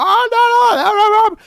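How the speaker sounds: high, loud mocking whine of protest; mock complaining commenters. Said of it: "you people can get in my comments talking about".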